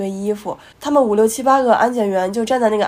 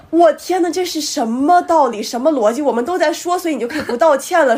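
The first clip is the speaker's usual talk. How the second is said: outraged disbelief; 6 semitones higher, 4 dB louder than usual. Retold or imitated. Retold outraged reply, laughing.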